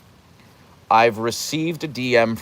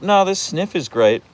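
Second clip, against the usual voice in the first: higher-pitched voice for a distracted dog; 7 semitones higher than usual. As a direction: speak as a dog too busy sniffing. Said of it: "and he's like".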